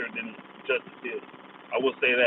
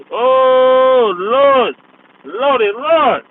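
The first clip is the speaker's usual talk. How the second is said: laughing lord; 9 semitones higher, 8 dB louder, much slower than usual. Real, not acted. Howling at mocked Packers fan.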